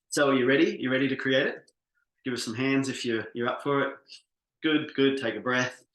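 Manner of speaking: rally the crowd, get them ready; hyping up the room. Real, not acted. Coach firing up his workshop audience, loud and upbeat.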